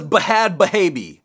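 "Bahad Bahabi" sounded out as buh-HAD buh-HAY-bee; mock literal reading. Shown as a demonstration. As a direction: stress the misspelt h's in "Bahad Bahabi". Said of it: "It says".

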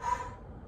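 a long, breathy sigh of feeling; emotional sigh. Real, not acted. Pausing while retelling the dog's snakebite, real emotional sigh. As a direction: let out the memory in a heavy sigh. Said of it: "I'm getting a little bit emotional".

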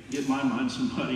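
ordinary talk, nothing marked

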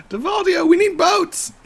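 high, loud holler; mock pleading shout. Player calling on the developer for boats, high mock shout. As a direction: call out in hammed-up demand.

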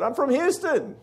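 high-pitched imitation Texan; lightly mocked Texas accent, pitched high. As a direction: imitate a proud Houston Texan. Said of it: "in a Texas accent".

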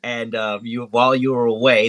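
usual speaking voice

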